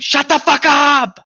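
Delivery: high, loud, slow shrieked insult; screamed. Retold or imitated. Girl screaming at a classmate in a lecture hall, shrieked. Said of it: "And she screamed".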